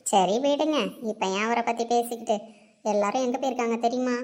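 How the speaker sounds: ordinary talk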